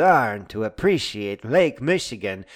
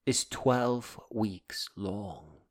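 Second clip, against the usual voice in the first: very slow and soft; revealing the shortest adventure, slow and quiet; 8 dB quieter, much slower than usual. Acted. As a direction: let it land slowly. Punchline delivered slowly.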